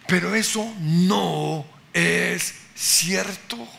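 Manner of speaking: impassioned sermon delivery; forceful preaching. Acted. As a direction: preach it with growing conviction. Preacher building to full force.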